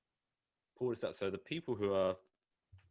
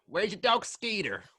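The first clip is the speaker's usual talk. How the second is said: mock American accent; 12 semitones higher, 7 dB louder, slower than usual. British guest teasing about a rodeo crowd, mock American accent. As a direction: put on a hammed-up American drawl. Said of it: "best American accent".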